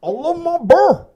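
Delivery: put-on delighted girlfriend hugging her prize bear, squealing; high squeal. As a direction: squeal it like a delighted Southern girl. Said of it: "And then she says".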